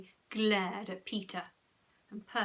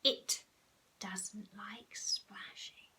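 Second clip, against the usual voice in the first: slow, hushed, ominous; soft, spaced-out secret; whisper; 5 dB quieter, much slower than usual. A narrator's reading. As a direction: whisper a warning about something lurking. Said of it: "whispered Henry".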